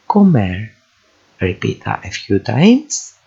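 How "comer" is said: comer run together as one word, falling at the end; joined syllables. Shown as a demonstration. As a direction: join CO and MER without a gap. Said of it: "two syllable words".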